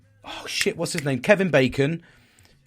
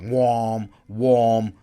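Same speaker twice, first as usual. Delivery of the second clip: drawn-out "wham" like an alarm; imitated warning buzzer; 3 semitones lower, 5 dB louder than usual. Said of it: "gives him that warning".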